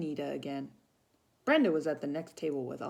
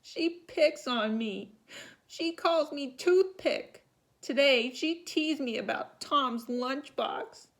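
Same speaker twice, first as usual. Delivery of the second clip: put-on tearful girl; 10 semitones higher, 5 dB louder, slower than usual. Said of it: "hiccuping between sobs".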